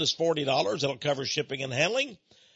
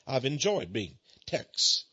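ordinary delivery

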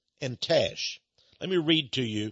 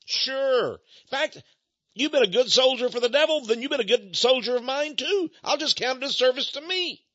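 higher mock-generous tone; sarcastic; 7 semitones higher than usual. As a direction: play God as absurdly easygoing. Mocking God's imagined reply.

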